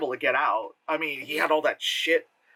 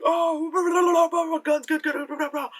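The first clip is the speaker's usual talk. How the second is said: mock cartel gibberish. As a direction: rattle off fake tough-guy jabber. Mock cartel talk on phone.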